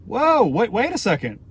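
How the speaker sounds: imitating a high startled whoa that settles down; mock surprise. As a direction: act out the viewer catching on. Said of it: "You're gonna be like".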